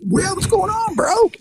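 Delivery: very high put-on voice; mock chummy greeting. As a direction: greet him in a silly falsetto. Mock version of his approach.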